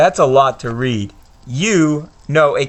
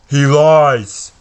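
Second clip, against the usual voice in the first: louder, menacing villain voice; Voldemort; 2 semitones higher, 5 dB louder than usual. Acted. Voldemort telling Quirrell the boy lies, menacing. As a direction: accuse him coldly as the dark lord. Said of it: "That's my Voldemort impression".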